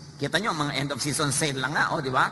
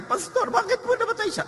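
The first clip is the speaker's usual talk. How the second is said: much higher, plaintive mock mourner asking why; grieving churchgoer; 4 dB louder than usual. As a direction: play the bewildered mourner, high and pleading. Pastor voices grieving churchgoer.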